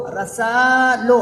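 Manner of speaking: रसा लो drawn out loud as a teacher's model; loud slow demo. Shown as a demonstration. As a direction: call out रसा लो slowly and strongly so the class catches it. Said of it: "इस तरह से बनेगा".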